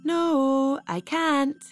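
hint of an excited child; child shouting back. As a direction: call back from the bathroom. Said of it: "Then Marie shouts".

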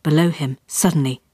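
ordinary delivery, nothing marked

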